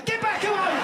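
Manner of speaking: high, loud panicked shout; hammed-up shriek to ward him off; mock alarm. British woman approached by a stranger, mock-alarmed shout.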